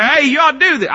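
hammed-up busybody telling you what to do; nagging advice. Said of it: "people say".

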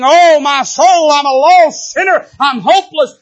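a sinner crying out in despair to God; anguished cry. Pretend. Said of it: "Instead of saying".

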